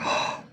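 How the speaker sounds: impressed gasp. Retold or imitated. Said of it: "they'll be like".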